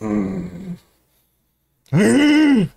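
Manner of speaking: lightly voiced annoyed worker; Yank brooding. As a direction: brush them off, lost in thought. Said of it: "그러니까는 앙크가".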